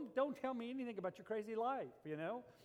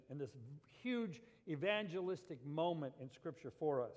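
ordinary delivery

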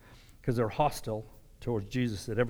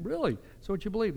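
higher, sneering disbelief at the other's faith; incredulous mock retort, pitched up; scoffing challenge; 6 semitones higher, 5 dB quieter, faster than usual. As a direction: mimic someone mocking a believer. Mocking a scoffing unbeliever.

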